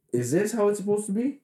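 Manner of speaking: incredulous. Retold or imitated. Retelling disgust at thick beer.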